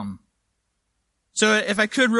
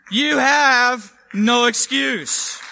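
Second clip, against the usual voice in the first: louder, higher and slow, every word weighted; emphatic declaration; 5 semitones higher, 6 dB louder, much slower than usual. Real, not acted. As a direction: hammer home that there is no excuse. Preacher drives home the point.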